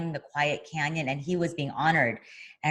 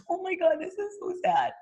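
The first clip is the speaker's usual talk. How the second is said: mock sad wail. Watching Senior cry.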